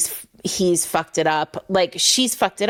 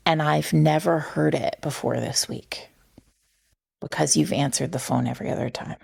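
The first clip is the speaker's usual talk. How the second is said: whispered, breaking off into a soft hurried murmur; a whispered retold confession, trailing away fast; hushed whisper; 3 semitones lower, 4 dB quieter than usual. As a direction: hush it as if confessing something painful. Retelling the heroine's emotional confession.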